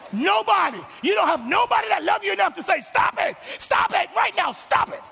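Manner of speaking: loud, very high exasperated outburst; incredulous shouting. Acted. Preacher begs friends of bad contestants to intervene, shouting incredulously. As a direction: yell it in comic disbelief.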